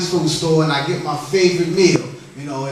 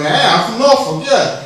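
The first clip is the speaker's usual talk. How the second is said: higher, louder playful back-and-forth; acting out a hometown boast; lightly hammed-up banter; mock bragging exchange; 5 semitones higher, 3 dB louder than usual. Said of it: "getting all around the world".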